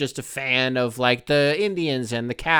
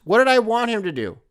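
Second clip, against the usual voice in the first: ordinary talk, nothing marked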